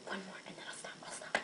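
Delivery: whispered. Real, not acted. Quiet promise to stop.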